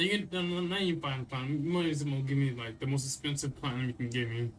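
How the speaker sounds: ordinary delivery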